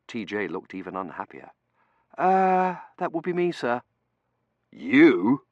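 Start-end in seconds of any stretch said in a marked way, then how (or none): 2.2-3.8 high, hesitant admission; sheepish junior. Acted. T J admitting he is in charge, sheepish and high. 4.7-5.4 loud, swooping question; surprised. A narrator's reading. Dunworthy learning the undergraduate is in charge, surprised. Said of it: "said in surprise".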